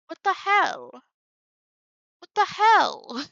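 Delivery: loud, high-pitched exasperation; exasperated outburst. Real, not acted. Streamer to the screen over unwanted blur, exasperated outburst.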